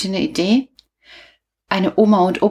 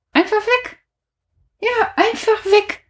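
high squeak of dismay; 13 semitones higher than usual. Real, not acted. Dismay at the lost show.

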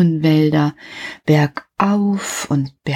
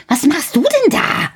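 put-on high child's voice calling upward; a curious boy shouting up a tower; calling up as Häwelmann; 10 semitones higher than usual. A narrator's reading. Häwelmann calls up to the rooster, high and curious. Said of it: "rief der kleine Häwelmann hinauf".

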